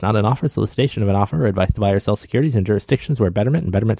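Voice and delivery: rapid, low-pitched fine-print voice; legal disclaimer read. Acted. Host reading Betterment's legal notice after the show, rapid disclaimer. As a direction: rattle it off like small print at an ad's end.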